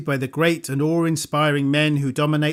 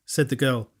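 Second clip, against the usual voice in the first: ordinary delivery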